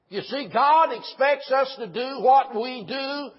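slow, loud, high-pitched pulpit proclamation; forceful preaching. Real, not acted. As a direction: drive each word home to the congregation.

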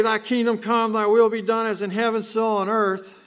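mock the rushed habit of praying from memory; rattled-off rote prayer. Shown as a demonstration. Preacher mimicking how people pray it fast: loud rote rattle. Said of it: "and people pray it fast".

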